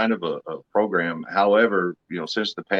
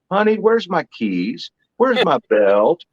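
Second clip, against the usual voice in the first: higher, drawn-out questions; lightly acted needy boyfriend, pitched up; mock helpless boyfriend; 9 semitones higher, 4 dB louder than usual. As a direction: call out helplessly to your honey. Boyfriend helplessly asking his girl, acted.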